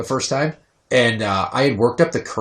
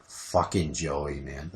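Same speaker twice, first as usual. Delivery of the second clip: exasperated mutter; 8 semitones lower, 8 dB quieter, much slower than usual. Retold or imitated. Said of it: "I just keep saying to myself".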